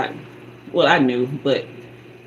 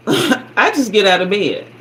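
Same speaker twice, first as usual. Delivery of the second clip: normal talking voice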